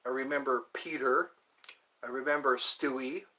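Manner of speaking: ordinary delivery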